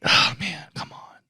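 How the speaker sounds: soft, then a whisper; a quiet gamer sigh of annoyance; muttered frustration. Retold or imitated. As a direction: mutter it under the breath. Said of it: "they're like".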